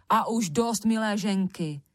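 usual speaking voice

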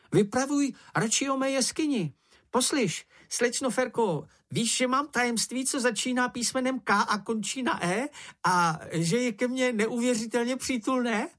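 very high, excitable small-animal voice; eager little creature. Acted. Čenich tells Miss Ferka about his secret, eager high creature voice. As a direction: pipe up high, bursting with a secret.

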